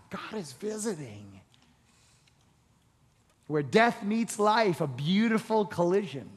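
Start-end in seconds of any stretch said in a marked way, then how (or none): 0.1-1.4 much softer, hushed restatement; softer repeat. Shown as a demonstration. Preacher repeating the line to coax an amen, hushed. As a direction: drop the volume and let it sink in. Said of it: "Let me say it again".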